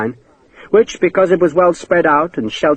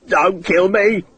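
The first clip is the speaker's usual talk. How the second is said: a mildly raised, pitched-up cry for his life; shouted plea; 5 semitones higher, slower than usual. Acted. Naked Hakeswill begs Sharp at sword point, mild mock shout. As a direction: beg him not to kill you. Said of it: "he shouted".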